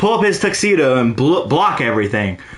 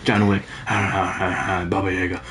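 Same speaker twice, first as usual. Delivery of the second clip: hushed, much softer movie-villain mumble; imitating villains who barely speak up, much softer; mock villain whisper; 10 semitones lower, 6 dB quieter than usual. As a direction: breathe the names like a secret menace. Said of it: "like, almost whispering".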